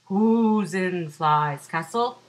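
loud, slow calling wolf; big wolf voice. Acted. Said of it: "The wolf calls out".

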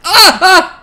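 high, loud startled laugh-yelp; startled cry. Real, not acted. Player jolted by a scare, nervous laugh. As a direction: burst out in shocked nervous laughter.

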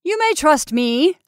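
smug promise; self-satisfied. A narrator's reading. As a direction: sound pleased with yourself. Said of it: "I heard his self-satisfied voice say".

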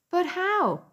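put-on high, surprised child voice; pleading for an answer; disbelieving. Keith demanding an explanation.

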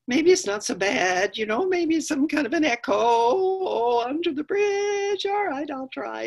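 drawn-out wavering that rises high; hesitant medium goat. Acted. Medium goat talking himself into crossing, high and hesitant. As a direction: reason nervously, climbing in pitch.

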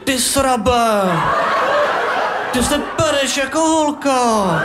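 high mocking taunt, shouted; boy roaring a taunt in a high voice; bully's yell. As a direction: sneer it at him like a playground bully. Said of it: "Zařval".

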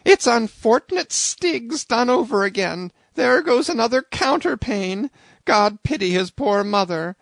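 high, loud flustered innkeeper; fretting landlady. A narrator's reading. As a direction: rattle on like a panicked landlady. Landlady voice held.

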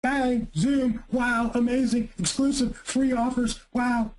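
mock spammy hype. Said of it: "Twitter winner".

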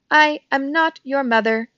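measured robot correcting a chick. A narrator's reading. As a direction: state it firmly as a robot. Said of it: "said the robot".

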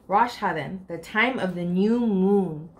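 ordinary delivery, nothing marked

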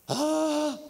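high, loud, held "ah"; reenacted cry. Man overtaken by the Holy Ghost, high held cry. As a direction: let out a sustained high cry.

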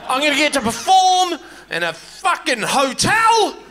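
high, shouted dreamy boast; childhood dream voice. Retold or imitated. Comic voicing his younger self, excitedly dreaming. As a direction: dream big like a hopeful kid. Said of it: "I thought".

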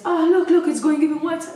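ordinary talk

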